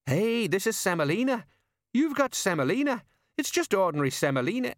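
high, indignant outrage at the food; disbelieving complaint, rising; indignant surprise. Acted. Colon shocked at food.

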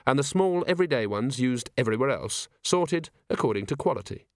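plain reading voice

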